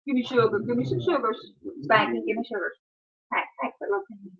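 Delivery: soft cooing to the dog. Real, not acted. Owner softly asking her dog for kisses, cooing. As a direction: coo softly for a kiss. Baby talk to dogs.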